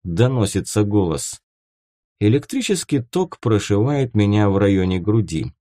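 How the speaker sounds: ordinary delivery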